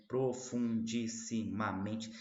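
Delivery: "Profundissimamente" lento, sílaba por sílaba; silabação. Shown as a demonstration. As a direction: conte as sete sílabas de "Profundissimamente" ao dizê-las. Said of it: "sete sílabas". ("Profundissimamente" silabado devagar, para contar)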